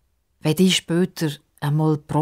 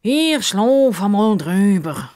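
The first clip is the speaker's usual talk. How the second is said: yawning; 5 semitones higher, 5 dB louder, much slower than usual. Acted. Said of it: "sagt der Justus und gähnt".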